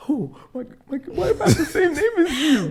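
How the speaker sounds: high squealing giddy voice; mock giddy flirt. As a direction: swoon over the name in a high voice. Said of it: "and then I'm gonna be like".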